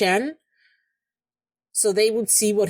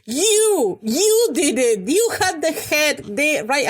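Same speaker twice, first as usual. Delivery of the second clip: high, heated outburst at a character; real angry yell, pitched up high; angry screaming; 7 semitones higher, 3 dB louder, slower than usual. Real, not acted. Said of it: "I feel like I'm screaming".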